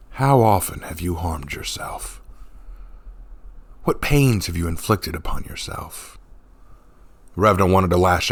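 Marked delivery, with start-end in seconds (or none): 0.1-6.2 gentle. A narrator's reading. The monk gently asks Revna about her pain. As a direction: ask tenderly.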